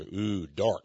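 low, hushed spooky tone; ominous. Pretend. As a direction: drop into a dark ominous hush. Preacher spooks about the villain.

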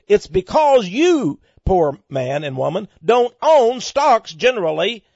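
pulpit-style denunciation; fiery preaching. Acted. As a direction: build the accusation against the rich. Preaching against the rich.

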